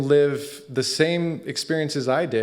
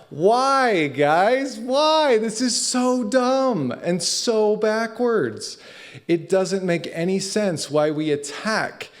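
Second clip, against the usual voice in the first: exasperated outburst; 9 semitones higher, slower than usual. Real, not acted. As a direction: throw the why at them, slowing down at the end. Exasperated plea to audience.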